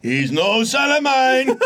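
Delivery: high, slow, shouted disowning; hammy furious father; dramatic dad. Pretend.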